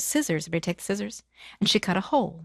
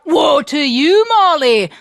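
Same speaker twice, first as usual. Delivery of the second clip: slow, loud lament; giant's cry; 6 semitones higher, 7 dB louder, slower than usual. Acted. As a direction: bellow it after her. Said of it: "The giant cried out".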